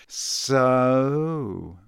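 long, lingering single word; slow, drawn-out. A narrator's reading. Malfoy advancing on his rivals, slow and drawn-out. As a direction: stretch the word out slowly. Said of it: "said Malfoy slowly".

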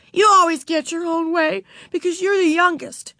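put-on high-pitched girl's accusation; arguing. A narrator's reading. Beezus accusing the youngest sister, high and argumentative. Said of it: "argued Beezus".

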